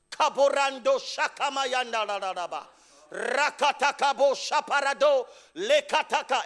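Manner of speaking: rapid glossolalia babble; speaking in tongues. Pretend. Preacher mimicking street prayer in tongues, babbling.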